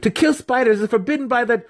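very high, rushed protest; panicked scream. A narrator's reading. Student warning his mentor about spiders, screaming. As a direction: gabble the rule at screaming pitch. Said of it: "Massage screamed".

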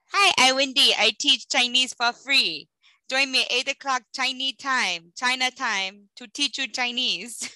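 imitating a Chinese teacher's English accent; mock Chinese accent. Host mimics TikTok Chinese teacher in Chinese accent. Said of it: "Imitating a bad English accent".

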